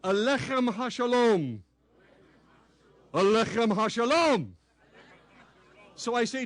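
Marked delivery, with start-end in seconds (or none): 0.0-1.6 slow "Alechem HaShalom" with a throaty ch; modelling the reply "Alechem HaShalom" for the congregation; Hebrew response demo. Shown as a demonstration. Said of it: "Your response is". 3.1-4.5 modelling the reply "Alechem HaShalom" for the congregation; Hebrew response demo. Shown as a demonstration. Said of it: "Your response is".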